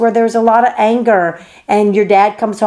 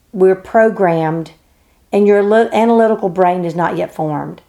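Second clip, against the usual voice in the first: ordinary talk, nothing marked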